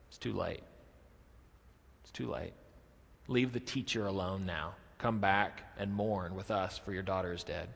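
low, soft and subdued; grave messenger. Retold or imitated. Messenger tells father his daughter is dead, grave and hushed. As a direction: break bad news quietly, words spaced out.

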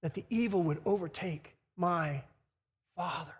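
low, soft, trailing to near nothing; hushed dread. Acted. Judah fears for his father, hushed halting dread. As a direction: let the voice sink away before the last word.

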